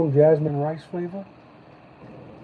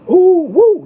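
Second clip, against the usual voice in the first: high, loud delighted whoop; excited whoop; 11 dB louder than usual. Real, not acted. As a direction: whoop with real delight at the box. Excited at the packaging.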